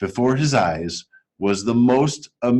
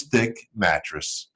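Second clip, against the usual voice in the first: plain reading voice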